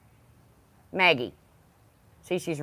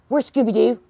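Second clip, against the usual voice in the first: higher, louder playful question to the dog; high, louder teasing call to a dog; excited dog tease; 8 semitones higher, 6 dB louder than usual. Real, not acted. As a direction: get the dog going with a bright sing-song.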